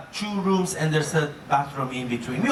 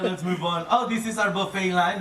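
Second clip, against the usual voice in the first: ordinary delivery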